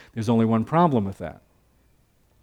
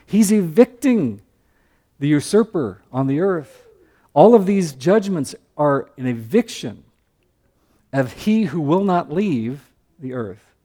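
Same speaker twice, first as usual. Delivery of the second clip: higher, drawn-out emphatic sermon push; building preaching; 4 semitones higher, 3 dB louder than usual. Acted. Pastor telling the church of Satan's eviction, fervent preaching. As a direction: drive home the eviction.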